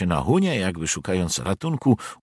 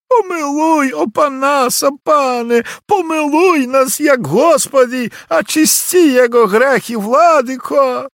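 high, loud desperate begging cry; pleading cry; 9 dB louder, slower than usual. A narrator's reading. Peasant girl crying out to the nobleman for mercy. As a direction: shriek for mercy at the lord's feet.

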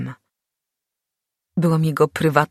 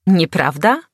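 scoffing; 3 semitones higher, 6 dB louder than usual. A narrator's reading. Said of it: "prychnął i zaśmiał się".

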